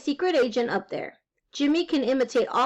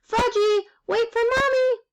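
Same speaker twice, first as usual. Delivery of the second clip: high, raised calling voice; hollering mother; 11 semitones higher, 4 dB louder than usual. A narrator's reading. Mother chasing her little boy, hollering high. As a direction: holler after a runaway child.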